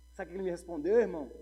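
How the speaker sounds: usual speaking voice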